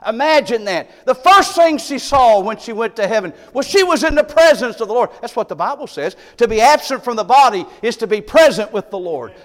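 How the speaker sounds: loud, high preaching build; fervent pulpit climax. Acted. Preacher building to full force.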